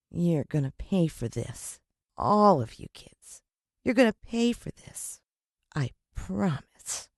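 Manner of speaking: a mild hint of a menacing whisper; menacing threat. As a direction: promise revenge slowly. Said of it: "he whispered".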